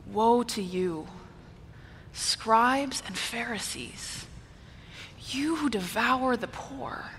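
soft, slow, grieving delivery; sorrowful lament. Shown as a demonstration. Preacher demonstrating another reading of Jesus addressing Pharisees, soft lament. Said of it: "Another one is".

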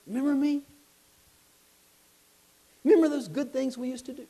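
put-on high, loud wheedling old man; loud coaxing tempter. Tempter in the jail calling to the believer, high and loud. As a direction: lure him back, calling up high.

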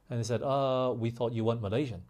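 ordinary delivery

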